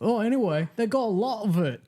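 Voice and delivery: high-pitched British colonist reporting in; eager mock-British messenger to the Prime Minister; mock British underling. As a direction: pitch it way up, calling to the boss. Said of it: "'cause they were like".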